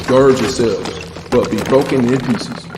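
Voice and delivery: ordinary talk, nothing marked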